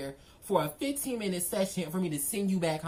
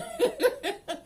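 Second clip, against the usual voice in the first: high burst of laughter. Real, not acted. Laughing at own joke.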